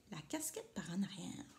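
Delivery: soft unvoiced whisper. A narrator's reading. Papa explaining the second E, whispered. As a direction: whisper it like a secret. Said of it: "le E avec une casquette".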